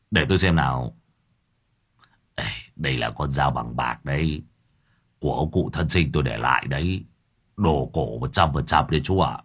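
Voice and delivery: low, soft offering; lightly voiced Lâm Thọt. A narrator's reading. Lâm Thọt offering the knife as payment, meek.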